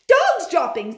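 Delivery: high, loud sharp shout; high witch shout. Acted. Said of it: "they shouted".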